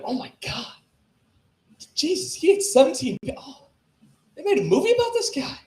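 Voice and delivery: high-pitched, incredulous mock shock; appalled amazement. Comic voicing someone watching the Dahmer special, astonished. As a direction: exclaim it as an astonished viewer.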